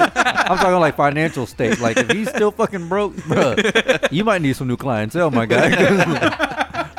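high, loud talk broken by laughter; can barely get it out for laughing; laughing hard. Real, not acted. Teasing a friend's dealer while laughing high and loud.

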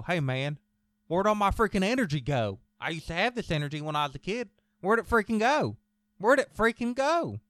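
high, loud quoted complaint; rising exasperated yell on the punchlines; A J Styles. Retold or imitated. Host quotes a wrestler on lost energy, high loud complaint. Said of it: "A J Styles".

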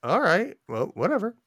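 high, shrugging mock voice; mock indifference. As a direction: brush it off in a high sing-song. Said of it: "I'm like".

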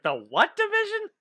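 high, baffled question; incredulous. Retold or imitated. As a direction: ask it in total disbelief. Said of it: "I was like".

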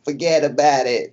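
put-on a light old New York accent, drawn out; a lightly put-on old New Yorker's New York accent. Mocking the old New York accent to a friend. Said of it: "you hear that old New York accent".

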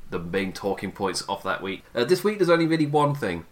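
ordinary talk, nothing marked

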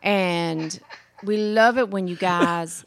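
sweet-talking Southern drawl; Southern accent with a laugh creeping in. Shown as a demonstration. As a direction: flatter them in a put-on Southern accent. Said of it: "that's how you do the Southern accent".

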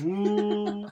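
loud, high whale-call whoop; imitated whale sound. Recalling Dory's whale bit to a co-host, imitated whale call. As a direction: hoot out a movie whale call. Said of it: "I love the whale sound bit".

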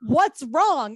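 high, loud, panicked questioning; retold alarm. Retelling a frightening phone call from friend's husband, alarmed. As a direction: demand the bad news, acting out the panic. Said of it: "literally, I think I had, like, a heart attack".

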